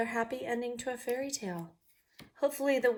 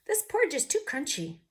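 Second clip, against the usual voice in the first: bear voice; 7 semitones higher, 4 dB louder than usual. Acted. Bear tasting porridge, voiced.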